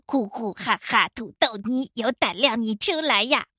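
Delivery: low, teasing taunt; deliberately annoying noises. A narrator's reading. Crow provokes the wolf into coming out, taunting. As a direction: make silly noises to goad someone. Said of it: "故意发出各种帅狼不喜欢的声音".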